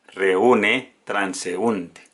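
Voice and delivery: ordinary talk, nothing marked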